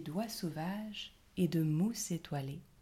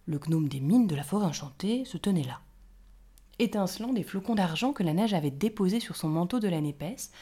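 plain reading voice

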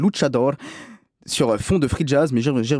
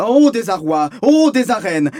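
louder, higher mock-tragic cry; theatrical lament; 5 semitones higher, 4 dB louder, slower than usual. Finding the video store closed, hammed-up theatrical lament. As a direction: ham up the despair like a stage actor. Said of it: "je fais du théâtre".

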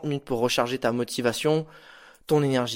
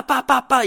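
coups de hache; 9 semitones higher, 6 dB louder than usual. Retold or imitated. Bûcheron qui coupe des arbres à toute vitesse.

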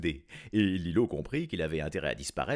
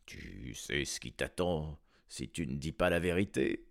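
sighing king; 5 semitones lower than usual. A narrator's reading. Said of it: "soupira et dit".

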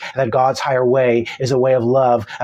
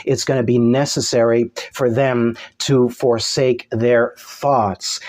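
ordinary talk, nothing marked

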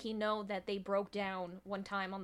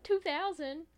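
high, loud incredulous outburst; mock disbelief; 8 semitones higher, 5 dB louder than usual. Hearing it is past Y2K, mock disbelief. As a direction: act floored, pitched way up. Co-host plays shock at the year.

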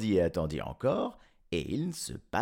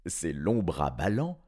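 ordinary delivery

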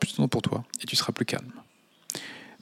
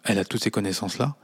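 ordinary delivery

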